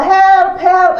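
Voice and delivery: high mock cry for help; imitating a child yelling. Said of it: "yung isa, ah".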